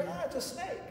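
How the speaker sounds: high, breathy-free mock shriek, kept soft; mock scream. Preacher mimics people screaming at a snake, high mock scream. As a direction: squeal it like a startled onlooker, but quietly. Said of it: "everybody screams".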